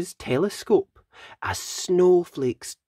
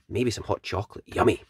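ordinary delivery, nothing marked

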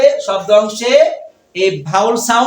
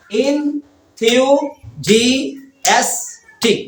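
very slow "enthusiastic" in five separate parts; long pause after each syllable of "enthusiastic"; teacher's drawn-out syllable demo; 3 semitones higher, much slower than usual. Shown as a demonstration. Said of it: "কয়টা হলো?".

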